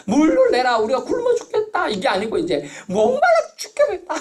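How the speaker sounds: high, loud, laughing mock whine of the thirsty crowd; hammed-up complaint from people mad with thirst; whining crowd. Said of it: "미치려고 하니까". (목말라 떼쓰는 백성 흉내, 높고 크게 웃으며)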